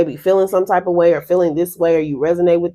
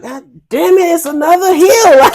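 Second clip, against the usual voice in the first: higher and louder, fed-up groan at the hill; exasperated outburst; 11 semitones higher, 7 dB louder than usual. Retold or imitated. Hiker at the top spotting another hill, exasperated and loud. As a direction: spit it out like you can't believe there's more.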